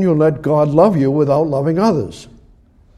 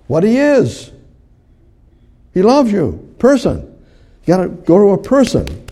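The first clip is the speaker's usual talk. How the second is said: high, loud, driving preacher's voice; fervent pulpit preaching; impassioned preaching; 6 semitones higher, 6 dB louder than usual. Acted. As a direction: preach it out with full force to the congregation. Preaching at full force.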